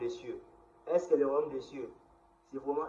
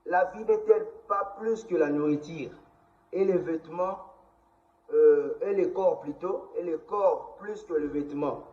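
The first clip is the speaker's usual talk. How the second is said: solemn public proclamation, higher and louder; scripture reading; 4 semitones higher, 6 dB louder than usual. Acted. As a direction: proclaim the verses loudly, phrase by phrase. Reads Bible verses aloud.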